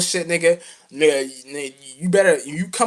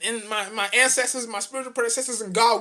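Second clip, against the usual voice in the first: ordinary talk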